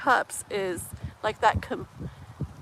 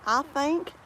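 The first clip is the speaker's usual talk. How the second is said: higher-pitched playful Texas twang; mimicked Southern accent; 5 semitones higher than usual. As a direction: try the Southern accent for fun. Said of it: "Is that where the accent came out?".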